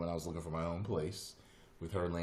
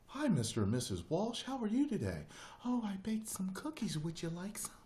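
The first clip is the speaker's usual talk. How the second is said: high, sweet fifties homemaker; old-fashioned proper lady, pitched high; Darlene; 13 semitones higher than usual. Retold or imitated. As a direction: imitate a prim neighbor offering hospitality. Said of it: "something almost out of the fifties".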